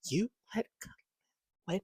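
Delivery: hushed, unvoiced whisper; whispered disbelief. Stunned that Khalil won, hushed whisper. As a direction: whisper the question in shock. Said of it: "I'm just like".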